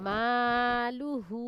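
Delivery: মালুহু read very slowly, the long "মা" stretched; drawn-out vowel. Shown as a demonstration. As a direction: hold the madd in মালুহু, teaching pace. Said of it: "এক আলিফ টেনে পড়তে হয়".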